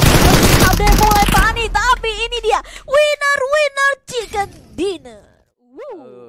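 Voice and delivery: high, loud, slow victory call; triumphant drawn-out announcement; winner hype call. Real, not acted. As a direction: stretch out winner chicken dinner with triumph. Caster calls the match winner.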